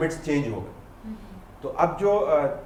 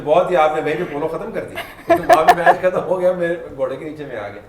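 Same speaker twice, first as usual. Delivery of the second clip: words spilling through laughter, louder; laughing while talking; 3 semitones higher, 7 dB louder than usual. Real, not acted. Host joking about the match ending under the horse, laughing through words. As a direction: joke it out between laughs.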